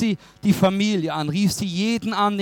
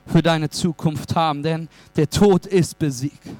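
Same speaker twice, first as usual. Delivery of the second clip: normal talking voice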